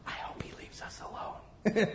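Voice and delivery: hushed, breathy aside; whisper. Retold or imitated. Husband whispering to his wife in a restaurant. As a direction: whisper so the man won't hear. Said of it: "he whispered to his wife".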